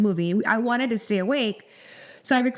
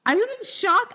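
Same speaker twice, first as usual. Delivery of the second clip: pitched way up in disbelief; shocked; 10 semitones higher than usual. Real, not acted. Telling the host how the passenger stunned her, shocked. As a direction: still stunned, it jumps high. Recalls seatmate's rude reply.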